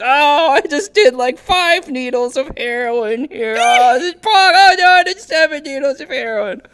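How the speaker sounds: high, loud, mocking brag; mocking boastful bragger. Mocking someone who brags about heroin as a contest, high and loud. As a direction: ham up a proud show-off bragging about heroin. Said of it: "you don't say that about, like, heroin".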